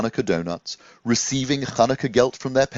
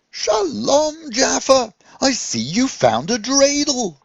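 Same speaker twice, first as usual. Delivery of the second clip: much higher, louder jolly rabbi voice; cheery rabbi greeting; 5 dB louder, slower than usual. Acted. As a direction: greet him like a delighted old friend. Rabbi Levi greets Jaffa.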